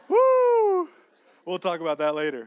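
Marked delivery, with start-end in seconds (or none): 0.1-0.9 high, loud "woo"; piercing whoop after a laugh; excited whoop. Real, not acted. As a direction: let out a long, high whoop. Whoops amid laughter while preaching.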